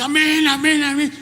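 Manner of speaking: high echoing mock amens; a hint of a crowd answering amen; mock congregation amens. Preacher imitating the congregation shouting amen, lightly high. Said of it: "Y la gente".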